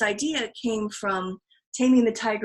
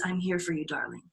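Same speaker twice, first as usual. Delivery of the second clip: tender reassurance; 3 semitones lower, 6 dB quieter than usual. Retold or imitated. Husband's tender reply.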